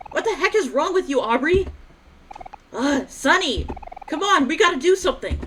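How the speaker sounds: high, loud shouting at a friend; angry shout. Acted. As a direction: shout to stop the fight. Kids shout during a fight.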